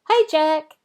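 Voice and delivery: put-on high, bright kid voice, calling out; lively child greeting. A narrator's reading. Jack calls out to his friend Rebecca, high and loud. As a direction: call hello like an excited kid.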